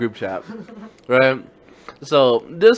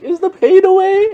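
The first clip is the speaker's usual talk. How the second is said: much higher and louder squeaky voice; mock scared friend; 8 dB louder than usual. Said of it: "he was like".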